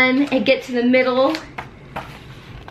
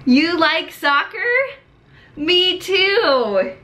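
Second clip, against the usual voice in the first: higher, bright and enthusiastic; excited teacher; 7 semitones higher, much slower than usual. Shown as a demonstration. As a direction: cheer the student on.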